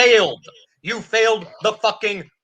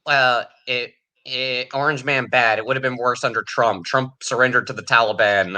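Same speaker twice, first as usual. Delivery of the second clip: lower, dopey mock voter voice; mocking Biden voter; 7 semitones lower than usual. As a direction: play the clueless supporter parroting excuses. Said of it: "look at this and go".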